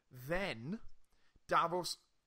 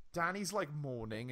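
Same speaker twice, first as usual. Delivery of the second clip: ordinary talk, nothing marked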